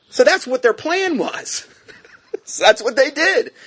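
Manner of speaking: laughing through the words, pitch jumping up. Real, not acted. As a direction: let the amusement spill into a laugh. Laughing while telling the plan.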